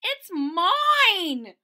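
high loud yell. Acted. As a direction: yell it's mine. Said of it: "she yelled".

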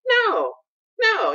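very high-pitched retold no; emphatic mock denial. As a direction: squeak out an acted denial. Acting out answer to shocked listener.

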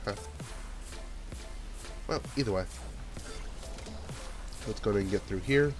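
ordinary talk, nothing marked

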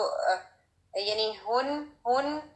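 usual speaking voice

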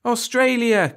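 put-on high, loud children answering together; shrill chorus. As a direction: shout it out as the whole class. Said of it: "clanged the shrill chorus".

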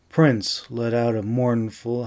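plain reading voice

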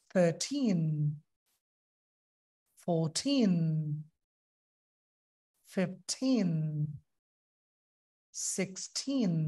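Thirteen to nineteen said slowly, each টিন leaned on; stress on teen. Shown as a demonstration. Teacher slowly modelling the teens for students. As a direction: lean on every টিন. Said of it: "সব টিনের উপর জোর দিবেন".

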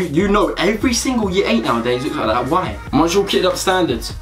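ordinary delivery

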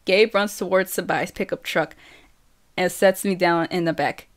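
ordinary delivery

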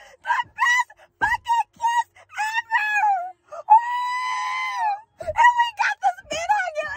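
very high, tearful shrieks of joy; overjoyed squealing. Real, not acted. Fan watching the kiss, squealing with joy. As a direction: let the excitement burst into a drawn-out squeal.

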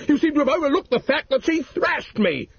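high, hammed-up aggrieved protest; indignant complaint. A narrator's reading. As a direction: whine that he's the victim. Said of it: "yelled Mister Racine".

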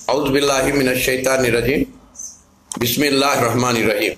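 murmured prayer recitation; recited prayer. Real, not acted. Recites prayer before preparing remedy.

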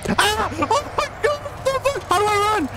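real terrified high shrieks; shrill frightened yelling, very high pitched; panicked screams. Real, not acted. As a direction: scream in panic while fleeing. Chased in horror game, screaming.

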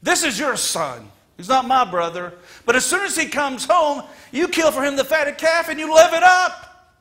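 loud bitter accusation rising high; resentful son. Acted. Older son accusing father, loud and bitter. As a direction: hurl it at the father with spite. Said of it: "wouldn't even call him his brother".

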